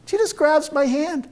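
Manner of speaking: ordinary delivery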